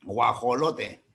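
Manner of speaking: guajolote said slowly, drawn out; Mexican word. Shown as a demonstration. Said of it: "¿cómo dicen?". (guajolote pronunciado lento, a la mexicana)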